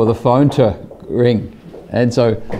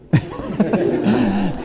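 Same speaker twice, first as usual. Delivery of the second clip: laugh; 10 semitones higher, 5 dB quieter than usual. Real, not acted. Laughing at the calm reply.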